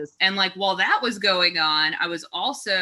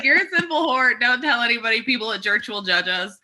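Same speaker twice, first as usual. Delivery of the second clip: mock pious grandparents; 5 semitones higher than usual. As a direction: ham up the outraged churchgoers. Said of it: "They're like".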